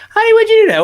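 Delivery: shrieky, loud falsetto wife; imagined wife. Acted. Wife questioning her husband's article, hammed-up falsetto. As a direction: put on a very high wife voice. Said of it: "his wife's like".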